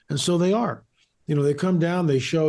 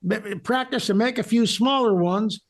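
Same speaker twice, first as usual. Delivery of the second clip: mock Southern builder, pitched up high; Southern accent; 7 semitones higher than usual. Guest plays pyramid builder rallying the boys, Southern accent. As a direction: play a clueless Southerner in a high voice. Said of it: "that was a good accent".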